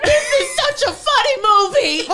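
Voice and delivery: high, laughing mock delight; forced cheerful laugh; laughing fake cheer. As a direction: pretend it's hilarious through laughter. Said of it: "you should've been like".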